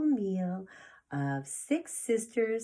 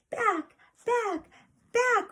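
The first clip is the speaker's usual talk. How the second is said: urgent back; 5 dB louder, slower than usual. Acted. Sister running to catch baby.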